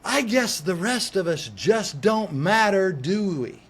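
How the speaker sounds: slow, loud sarcastic jab; sarcastic yell. Retold or imitated. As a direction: shame them out loud, slowly. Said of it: "and I yell".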